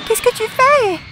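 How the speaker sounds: high, loud tearful cry; a distressed sob-shout. Acted. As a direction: cry it out in shock. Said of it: "j'ai dit en pleurant".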